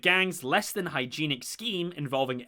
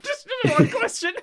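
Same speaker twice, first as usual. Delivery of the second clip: quoting a character; 4 dB louder than usual. Retold or imitated. Quoting show line.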